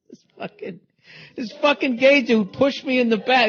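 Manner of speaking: ordinary delivery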